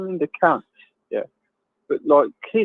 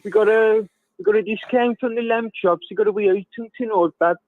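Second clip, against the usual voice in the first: higher-pitched, hammed-up Brummie accent; 7 semitones higher than usual. Mimicking a Birmingham market seller to a friend, in a hammed-up Brummie accent. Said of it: "I'm gonna try and do a Brummie accent now".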